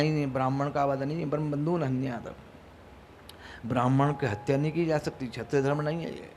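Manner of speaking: low, very soft voice; quiet, grave ruling. Acted. Dharmaraj rules against killing a Brahmin, quiet and grave. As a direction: rule gently, in a hushed low tone.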